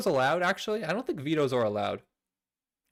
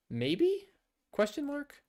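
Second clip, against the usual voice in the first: much higher, coy question; mock unsure squeak; 12 semitones higher, 3 dB quieter, slower than usual. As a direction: play dumb in a tiny voice. Mock uncertain high voice.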